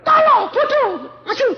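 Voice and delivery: teriakan guru yang tinggi dan lambat; guru marah. Retold or imitated. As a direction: panggil murid bodoh dengan kesal. Guru memanggil murid dengan marah. (guru marah memanggil murid bodoh maju)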